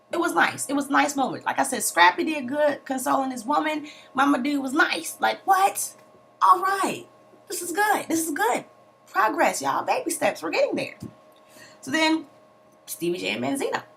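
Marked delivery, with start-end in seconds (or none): none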